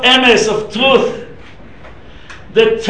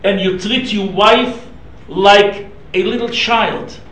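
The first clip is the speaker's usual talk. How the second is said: normal talking voice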